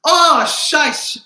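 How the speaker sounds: high, loud startled outburst; shocked exclamation. Retold or imitated. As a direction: blurt it out as if caught off guard. Blurting a curse in front of auntie.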